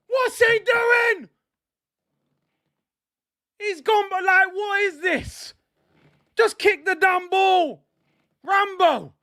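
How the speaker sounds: real fan fury, pleading to kick the ball; exasperated yell. Real, not acted. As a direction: scream at the keeper to clear it. Shouting at keeper on screen.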